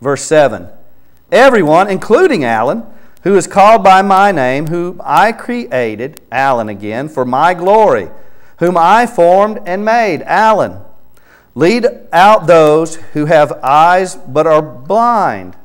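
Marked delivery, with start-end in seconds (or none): 1.3-6.2 raised, louder reading of the verse; fervent scripture reading. Acted. Preacher reading Isaiah aloud to Alan, fervent and louder. 7.1-15.5 raised, louder reading of the verse; fervent scripture reading. Acted. Preacher reading Isaiah aloud to Alan, fervent and louder.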